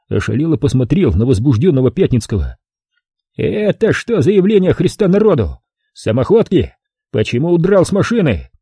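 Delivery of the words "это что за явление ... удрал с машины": злой окрик. A narrator's reading. Офицер набрасывается на Пятницкого.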